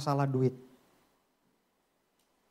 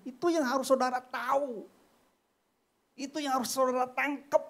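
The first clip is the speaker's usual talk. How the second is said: raised, louder preaching voice, urging; preaching at full force; 13 semitones higher, 5 dB louder than usual. Acted. Preacher urging the church not to hate, full-force preaching. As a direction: press the plea on the congregation.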